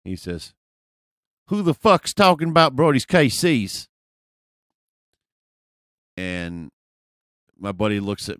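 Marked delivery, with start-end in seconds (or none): 1.5-3.9 loud, raised-pitch challenge; confrontational guy impression. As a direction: imitate a tough guy calling someone out. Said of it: "do my best impression of this guy".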